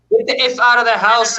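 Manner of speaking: hammed-up threat from a demon in the house; mock demon snarl. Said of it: "this thing growling and snarling".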